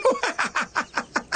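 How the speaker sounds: risa aguda que se apaga; risa burlona. Acted. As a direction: carcajada burlona de niño. Niños se burlan del nombre. (risa de niño burlón)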